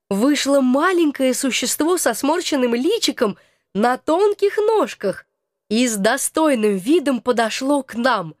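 plain reading voice

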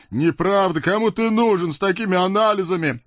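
high, cartoon devil voice scolding his friend; a chubby devil needling his buddy; devil Khryak. Толстый чёрт отвечает. (высокий мультяшный чёрт)

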